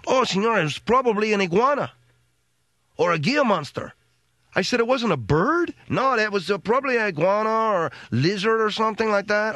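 lightly voiced Mexican worker, high; mild Mexican accent. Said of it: "Guy said".

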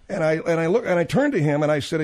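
ordinary talk, nothing marked

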